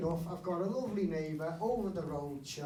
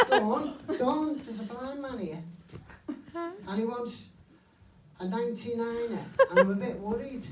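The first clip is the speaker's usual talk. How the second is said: fussing woman in queue; 4 semitones higher, 5 dB louder than usual. Retold or imitated. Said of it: "the woman who's waiting".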